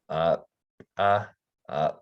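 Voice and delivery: creaky "uh" syllables cut off by a glottal stop. Shown as a demonstration. Teacher demonstrating glottalized syllables, creaky and clipped. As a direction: creak each "uh" and stop it in the throat. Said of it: "voiced after the glottal stop".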